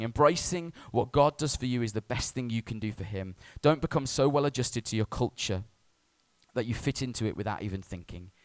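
ordinary talk

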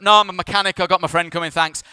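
imitated high, loud brush-off; dismissive refusal. Driver refuses his phone, imitated dismissive refusal. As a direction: mimic a driver waving help away.

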